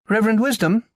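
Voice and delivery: a high call across the stairs. Acted. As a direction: call his name from the top of the stairs. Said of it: "someone calling his name".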